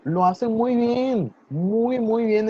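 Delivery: high, drawn-out, emphatic praise; delighted praise. Real, not acted. Teacher congratulating two students on their pronunciation, delighted high praise. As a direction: cheer the learners on, pitch up.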